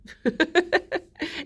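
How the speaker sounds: high burst of relieved laughter. Real, not acted. Relieved the stream ended.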